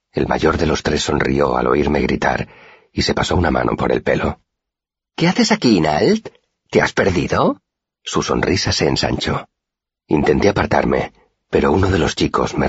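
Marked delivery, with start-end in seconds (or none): 5.2-7.6 high taunting sneer of a street bully; mocking bully. A narrator's reading. Pike sneering at the lost boy in the alley, high and mocking. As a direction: taunt him like a cruel older boy.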